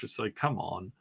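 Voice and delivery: ordinary delivery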